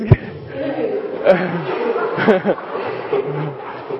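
real hearty laugh. Real, not acted. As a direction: laugh freely at the video. Laughing at dog video.

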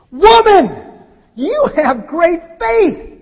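loud high Woman, then slow great faith; exclamation. Acted. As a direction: proclaim it, slow and strong. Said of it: "answered with an exclamation mark".

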